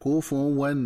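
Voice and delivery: "كفوا" recited "kufuwan", with u on the fa; chanted "كفوا" with a single light waw after fu; fa with damma. Shown as a demonstration. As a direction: say "كفوا" in three syllables, ku-fu-wan. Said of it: "تقرأ بضم الفاء هكذا".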